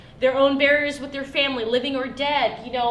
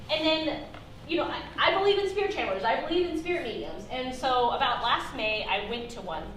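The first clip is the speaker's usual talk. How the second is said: ordinary talk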